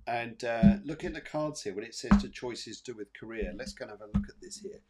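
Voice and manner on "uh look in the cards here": ordinary delivery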